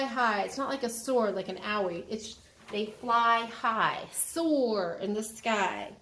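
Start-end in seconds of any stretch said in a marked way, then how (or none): none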